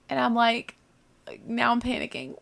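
ordinary delivery

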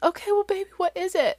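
high-pitched gentle mommy voice; retold coaxing mother. Mom asking crying daughter, high retold coaxing.

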